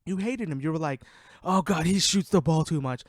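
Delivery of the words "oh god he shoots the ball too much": louder, exasperated imitation of griping fans; mock complaining. Podcaster quoting fans who hated on Westbrook, mock complaining. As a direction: mimic a fed-up hater.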